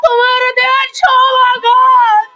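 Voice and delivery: wailing stage lament; melodramatic, weeping appeal; tearful pleading. Acted. Wounded elder pleading tearfully.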